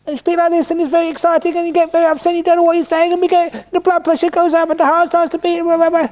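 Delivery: squeaky worked-up squabble voice; argument voice. Shown as a demonstration. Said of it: "start to speak high pitch and very fast".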